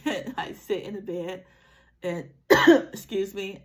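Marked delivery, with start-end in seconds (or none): 2.3-2.9 one sharp, loud real cough; sudden cough. Real, not acted. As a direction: cough out abruptly. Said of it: "I'm coughing now".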